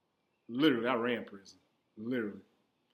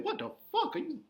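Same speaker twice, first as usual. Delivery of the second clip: high-pitched, baffled retort; incredulous outburst; 13 semitones higher than usual. Retold or imitated. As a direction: voice leaps way up in disbelief. Retold outburst at accusation in court.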